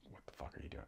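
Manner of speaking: a yell echoed back in a bare whisper; mock yell done in a whisper; whispered quote. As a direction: hush it, just repeating what the man outside shouted. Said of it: "Somebody's yelling outside and it's like".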